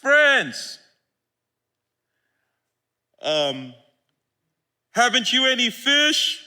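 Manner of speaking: a friendly call from the beach; Jesus calling out. Acted. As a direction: hail the disciples from the shore. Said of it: "He called out to them".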